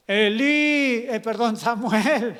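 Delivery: loud, stretched name call; acted calling voice. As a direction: summon someone asleep, then correct the name. God calls Samuel, name corrected.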